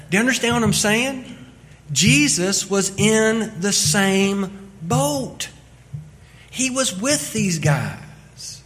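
loud, fast-building sermon delivery; preaching at full force. Acted. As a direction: drive the point home to the congregation. Preaching the key lesson.

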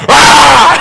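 terrified yell. Retold or imitated. Pastor startled awake bursts out at police, frightened yell. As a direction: let out a wild scared yelp. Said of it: "it sounded to them more like".

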